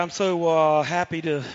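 ordinary talk, nothing marked

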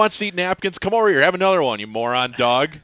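loud, sarcastic call to the dog; mock calling dog. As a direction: shout it at the dog, scornful. Mock offering napkins to dog.

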